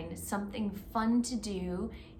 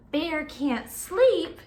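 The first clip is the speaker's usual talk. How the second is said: louder, slow, "sleep" pressed with a falling close; stress on sleep; 5 semitones higher, 5 dB louder, slower than usual. Shown as a demonstration. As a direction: lean hard on "sleep" and draw it out. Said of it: "But now".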